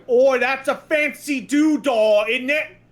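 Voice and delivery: Cockney dub voice admiring a rare item; mock Cockney shopkeeper. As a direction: gush over a rare item in a cheesy Cockney accent. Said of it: "a Cockney accent when you see a rare item going".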